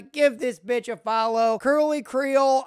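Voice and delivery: ordinary delivery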